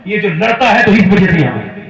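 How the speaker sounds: preaching at full force. Acted. Preacher roaring to the congregation about Umar. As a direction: cry it out to the crowd.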